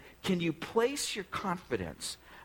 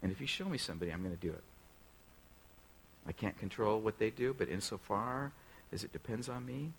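quiet modelled prayer; 3 semitones lower, 7 dB quieter than usual. Shown as a demonstration. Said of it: "You say".

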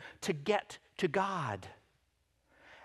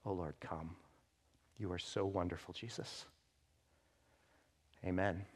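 soft, low murmured prayer to God; hushed prayer; 10 semitones lower, 12 dB quieter than usual. Real, not acted. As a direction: pray it quietly, almost to yourself. Opening prayer before the sermon.

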